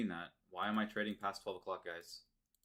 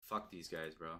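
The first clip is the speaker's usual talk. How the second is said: ordinary delivery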